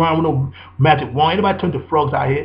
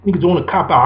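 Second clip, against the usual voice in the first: normal talking voice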